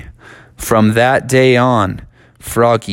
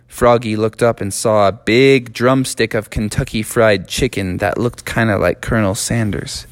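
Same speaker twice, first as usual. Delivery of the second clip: usual speaking voice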